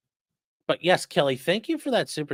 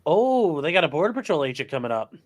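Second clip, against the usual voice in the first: usual speaking voice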